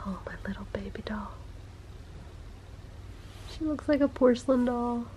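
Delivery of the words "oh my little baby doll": whisper. Real, not acted. Mother cooing over baby.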